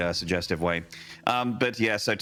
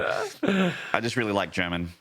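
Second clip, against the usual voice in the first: ordinary talk, nothing marked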